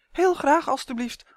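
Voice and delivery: high, eager polite acceptance; sweetly well-mannered reply. A narrator's reading. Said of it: "antwoordde Justin beleefd".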